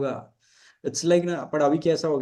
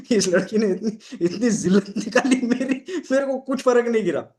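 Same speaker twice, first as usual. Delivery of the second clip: laughing; 7 semitones higher than usual. Real, not acted. Laughing at his old humiliation.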